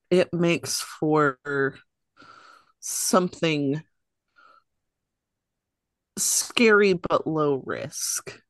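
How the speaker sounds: usual speaking voice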